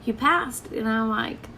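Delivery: ordinary talk, nothing marked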